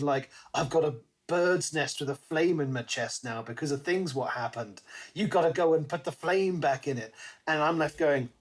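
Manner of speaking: ordinary talk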